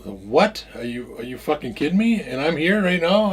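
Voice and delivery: outraged disbelief. Retold or imitated. Man retells confronting drunk friend, indignant. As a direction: build from startled question to loud protest.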